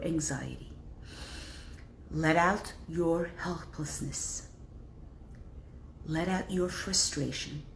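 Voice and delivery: a soft, unhurried meditation voice; guided breathing. Acted. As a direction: lead them to breathe it out gently.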